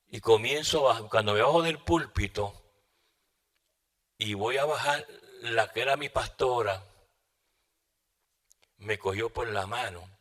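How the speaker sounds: normal talking voice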